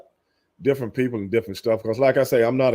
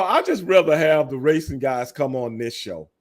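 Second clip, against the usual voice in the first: ordinary talk, nothing marked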